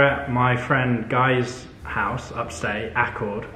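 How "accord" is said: normal talking voice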